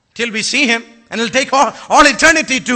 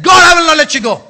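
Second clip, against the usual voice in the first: desperate shouted plea; 4 semitones higher, 6 dB louder than usual. Acted. Hannah's desperate cry voiced.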